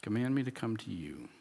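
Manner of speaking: a gentle, hushed request to the Lord; soft reverent reread. Shown as a demonstration. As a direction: let it fall soft like a confession. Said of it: "It's a whole different twist on this".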